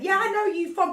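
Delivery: high, eager mock recognition; naive blurting. Naive witness answering the gunmen, high eager blurting. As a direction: pipe up as if delighted to know them.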